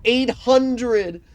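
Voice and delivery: high, loud cheer; excited shout. Real, not acted. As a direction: yell it out in delight. Huge damage roll.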